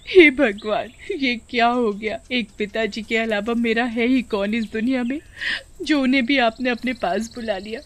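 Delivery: grief-stricken, hammed-up crying; tearful wailing. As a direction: wail to God as a bereaved daughter. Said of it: "Neha रोते हुए बोलती है".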